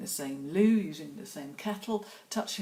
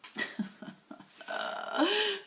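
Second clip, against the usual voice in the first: high laugh; short laugh. Real, not acted. Laughing while painting.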